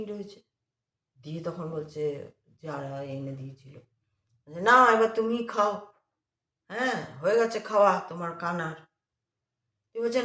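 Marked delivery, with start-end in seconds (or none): 4.6-8.8 mocking. Mocking old woman's offering.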